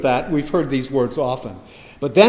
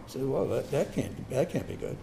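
dry understatement; 2 semitones lower, 13 dB quieter than usual. Real, not acted. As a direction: drop to a soft, wry aside. Wry understated aside.

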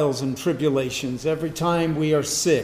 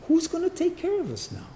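very high, put-on helpless voice; hammed-up worry in a high voice; mock anxious wail; 12 semitones higher, 3 dB quieter, faster than usual. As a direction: plead like a lost follower, pitched way up. Voicing worried Israelites without Moses.

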